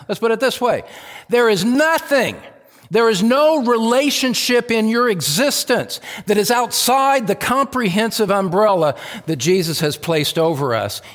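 high, forceful pulpit insistence; emphatic preaching. Real, not acted. Preacher to congregation, let's put it this way, emphatic preaching. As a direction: preach it high, driving each phrase home.

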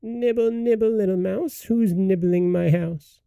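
put-on high, thin old witch calling from inside; shrill witch voice. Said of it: "a shrill voice called out".

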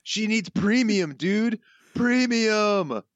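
high, loud, drawn-out cartoon crook; Snake. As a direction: stretch it into a high throat-straining holler. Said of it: "your best snake voice".

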